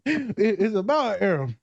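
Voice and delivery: slow, high comic put-on voice; funny voice. Host retrying the joke summary, funny voice. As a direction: drag it out high and silly. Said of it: "You just said it in a funny voice".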